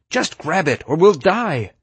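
high, pressing demand; urgent insistence. A narrator's reading. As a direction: insist as if lives depend on it. Urgent insistence during rescue.

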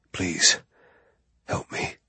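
breathless whispered plea; bleeding man whispering a plea; weak plea. Acted. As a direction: beg with almost no voice left.

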